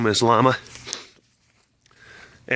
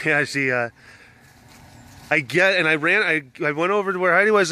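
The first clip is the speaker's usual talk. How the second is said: usual speaking voice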